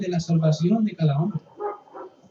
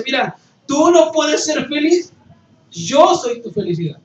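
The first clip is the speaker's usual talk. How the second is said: más alto, más fuerte y lento; proclamación fuerte; 7 semitones higher, 7 dB louder than usual. Acted. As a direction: declarar la voz de Dios con fuerza. Voz de Dios proclamada. (el predicador da voz a Dios, proclamando fuerte)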